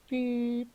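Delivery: high electronic beep sound; high mock beep. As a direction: squeak out a machine beep. Machine beep again.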